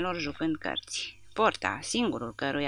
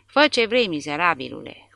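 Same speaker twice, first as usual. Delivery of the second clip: angry outburst; 5 dB louder than usual. Acted. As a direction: blow up at him in fury. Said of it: "explodând".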